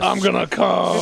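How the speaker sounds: slow, drawn-out joke moan; mock climax. Co-host heckling the host's slip, mock climax. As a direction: draw it out in mock ecstasy.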